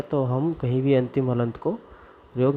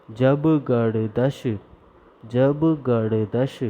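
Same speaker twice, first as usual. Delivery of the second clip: जब गड़दश said slowly and calmly; much slower than usual. Shown as a demonstration. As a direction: take जब गड़दश at an easy slow pace. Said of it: "आराम से इसको बोलेंगे।". (जब गड़दश आराम से)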